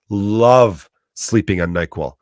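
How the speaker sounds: usual speaking voice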